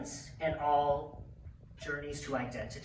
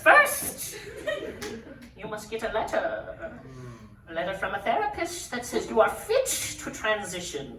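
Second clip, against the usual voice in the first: loud, high proclamation, opening on a huge cry; bridge troll; 5 semitones higher, 6 dB louder than usual. Retold or imitated. Troll doctor sets the first trial, loudly grandiose. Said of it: "And he says".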